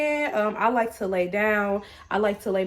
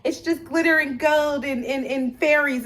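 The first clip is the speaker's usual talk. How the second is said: higher, airy-headed parody of spiritual believers; mocking spiritual folk; 6 semitones higher than usual. Vlogger mimicking naive spiritual people to viewers, mocking. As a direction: gush mockingly like a starry-eyed spiritual type. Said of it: "people think that".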